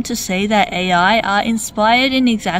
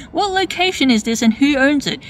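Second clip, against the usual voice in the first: ordinary talk, nothing marked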